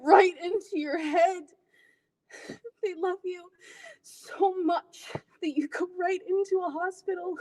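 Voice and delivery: hurt daughter breaking as she explains; tearful dramatic monologue. Acted. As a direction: let the pain rise through the pauses. Performs an audition monologue, tearful.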